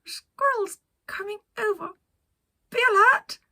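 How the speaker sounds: Penelope alerting her crew, squeaky and loud; urgent excited warning. Acted. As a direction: warn the crew in a squeaky rush.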